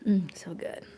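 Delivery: murmured whisper of delight. Real, not acted. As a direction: savour it in a whisper. Tasting tea quietly.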